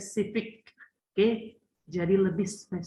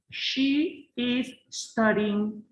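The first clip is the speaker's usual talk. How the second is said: slow model reading; much slower than usual. Shown as a demonstration. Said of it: "slow model sentence".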